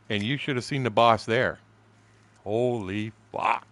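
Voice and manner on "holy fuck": normal talking voice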